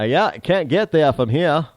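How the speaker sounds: imitated Down East Maine accent. Shown as a demonstration. Host showing off an accent he likes, Maine accent. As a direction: drop the r's like an old-time Mainer. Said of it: "I do happen to like the Maine accent".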